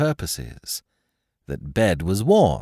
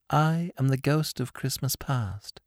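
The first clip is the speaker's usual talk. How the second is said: soft gentle ghost; 5 semitones higher, 5 dB quieter than usual. A narrator's reading. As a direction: speak softly, as if from far away. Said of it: "The voice was soft and gentle".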